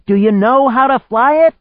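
very high, loud yell; full-voiced high-pitched shout. A narrator's reading. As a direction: scream the question over the storm. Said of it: "she shouted".

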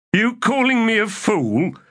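high-pitched, affronted question; offended retort, pitched well up; indignant challenge. A narrator's reading. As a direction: bristle at the insult, voice shooting up. Stormy takes offence at Gessler's jibe.

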